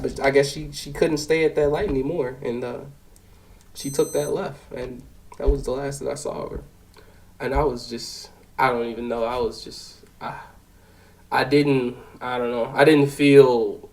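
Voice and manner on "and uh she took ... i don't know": trailing off, softer and lower; quietly lost for words after the call; subdued halting murmur, much softer; shaken, much softer. Real, not acted.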